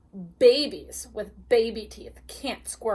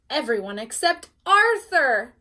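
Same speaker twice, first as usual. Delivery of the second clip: a loud teasing shout; louder taunting call; 2 semitones higher, 8 dB louder than usual. Acted. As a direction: shout it to mock Arthur. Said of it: "shouted Francine".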